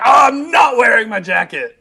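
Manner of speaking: high, laughing mock shout; Barrett impression. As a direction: ham it up like a fighting game character. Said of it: "He would just come out and be like".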